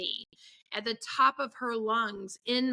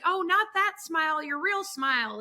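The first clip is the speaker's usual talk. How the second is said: higher-pitched mock mom correction; a hint of overbearing mom, pitched up; imitated nagging mom; 6 semitones higher than usual. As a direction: lightly act out a fussing parent. Overbearing mom voiced, correcting child's smile.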